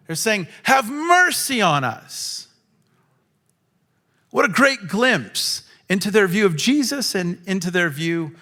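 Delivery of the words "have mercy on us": humble plea. Pretend. Humble plea contrasted with demand.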